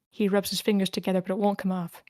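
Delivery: usual speaking voice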